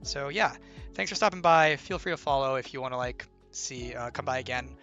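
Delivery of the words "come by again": normal talking voice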